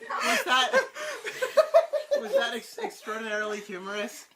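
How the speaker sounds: high, squeaky start, struggling through laughter; words broken by uncontrolled laughter; laughing fit. Real, not acted. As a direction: try to speak while laughing helplessly.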